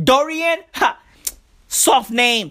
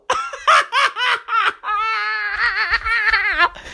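burst of laughter; 11 semitones higher than usual. Real, not acted. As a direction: crack up for real. Cracking up at the nuke idea.

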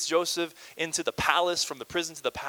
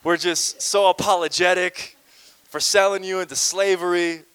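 hammed-up apology; 4 semitones higher, 10 dB louder than usual. Brothers beg Joseph's forgiveness, in a loud hammed-up apology. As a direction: ham up the brothers begging forgiveness. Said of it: "So they're like".

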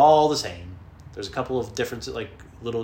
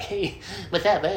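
higher, slow swaggering greeting; cocky boy showing off his haircut; cocky kid; 9 semitones higher, 4 dB louder than usual. Retold or imitated. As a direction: strut it like a cool kid.

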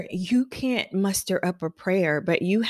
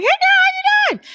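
much higher, louder, sing-song hello; bubbly greeting; 7 dB louder than usual. Acted. Hairstylist greeting her client with high energy.

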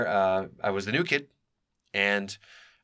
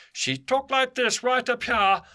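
very high, thick-in-the-throat voice, slow and loud; high-pitched throaty caricature of a woman; Hope impression; 5 dB louder, slower than usual. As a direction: squeeze it high in the throat, stretching every word. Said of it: "a really good imita-, imitation of her".

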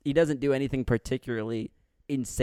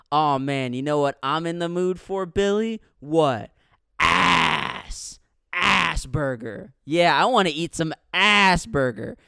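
loud, jeering bully voice; hammed-up jeering bully; mock bully taunt; 6 dB louder, slower than usual. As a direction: taunt like a dumb movie bully. Voicing bullies' joke.

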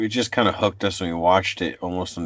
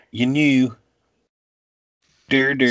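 hammed-up Pennsylvania Dutch accent, higher and sing-song; mock Pennsylvania Dutch accent; 5 semitones higher, 3 dB louder than usual. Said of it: "I don't know anybody that talks like that".

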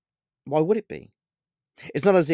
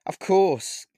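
nervous; 8 semitones higher, 3 dB louder than usual. Acted. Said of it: "I said nervously".

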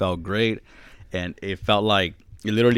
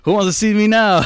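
high, louder cocky mock brag; proud boast; 12 semitones higher, 6 dB louder than usual. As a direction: show off like you own the room. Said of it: "I'm like".

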